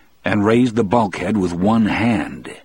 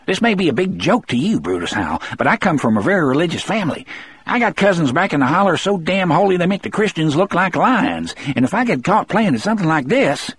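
high, reedy, indignant tirade; fussy, high-pitched harangue; thin, scolding voice; 11 semitones higher, faster than usual. A narrator's reading. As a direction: scold him rapidly in a pitched-up voice. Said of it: "scolding voice".

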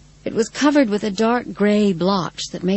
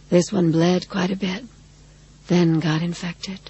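sweetly husky voice; 3 dB quieter than usual. A narrator's reading. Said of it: "in her sweetly husky voice".

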